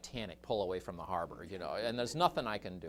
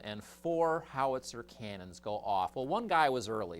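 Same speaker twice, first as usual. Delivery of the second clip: ordinary delivery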